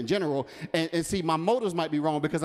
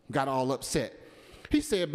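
normal talking voice

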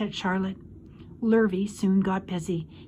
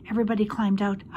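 ordinary delivery

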